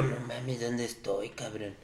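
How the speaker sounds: hushed, low and soft; panicked whisper. Retold or imitated. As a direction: whisper it, scared and lost.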